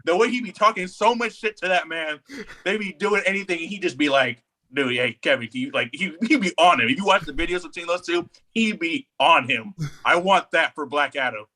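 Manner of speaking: ordinary talk, nothing marked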